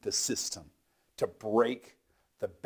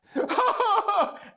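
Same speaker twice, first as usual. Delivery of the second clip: high laugh; 9 dB louder than usual. Real, not acted. Laugh after pained cry.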